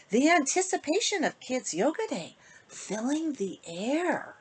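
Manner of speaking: loud, lilting storybook read with big rises; sing-song bedtime story voice for the little ones; reading to children. Acted. Author reading her book to kids in storybook voice. Reads rhyming picture book aloud.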